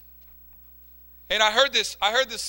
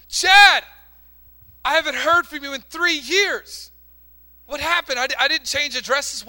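surprised mother; 7 semitones higher, 5 dB louder than usual. Retold or imitated. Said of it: "Chad's mother gets a phone call".

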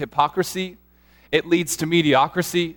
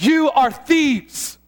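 slow, high and louder, each word weighted; Jesus confronting; 9 semitones higher, 4 dB louder, much slower than usual. Retold or imitated. Jesus rebuking the temple sellers, slow stern shout. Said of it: "he just goes".

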